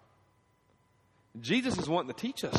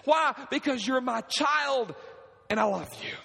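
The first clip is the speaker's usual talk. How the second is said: preaching at full force; 11 semitones higher than usual. Acted. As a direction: voice God's patient love with fire.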